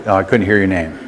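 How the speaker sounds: ordinary talk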